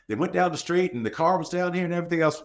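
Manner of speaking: high, frantic rush of words; panicked rush. Retold or imitated. Panicked witness pouring out the story to an officer, high and frantic. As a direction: play the panicked caller babbling.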